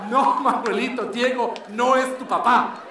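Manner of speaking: dramatic soap-opera reveal; mildly hammed-up telenovela line. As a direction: announce it like a cheesy twist. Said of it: "Spanish novel".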